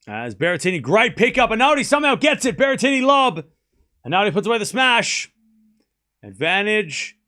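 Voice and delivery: rising commentator excitement, calling every shot; real thrill over a great rally; excited play-by-play. Real, not acted.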